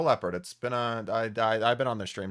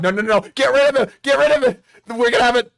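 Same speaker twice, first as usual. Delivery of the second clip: panicked; 10 semitones higher, 8 dB louder than usual. Real, not acted. Accidental screen mishap, panics.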